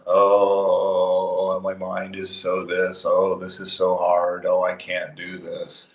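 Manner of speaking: mock a whining seeker, lightly voiced; hammed-up moaning about how hard it is; whiny mock complaint.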